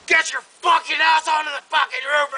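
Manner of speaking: high retold shout of a frantic uncle; imitated flipping out. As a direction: mimic him yelling an urgent order. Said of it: "he's just flipping out".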